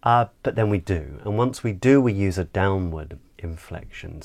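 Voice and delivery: ordinary delivery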